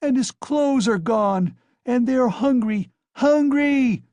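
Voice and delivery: high, loud, rising to a wail; desperate crying out. A narrator's reading. Mother pointing at her starving children, despairing wail. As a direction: plead for the hungry children. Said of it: "screamed in despair".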